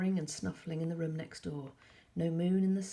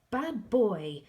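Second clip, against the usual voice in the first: loud, sharp telling-off; scolding; 5 semitones higher, 9 dB louder than usual. A narrator's reading. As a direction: scold the cat. Scolding the cat.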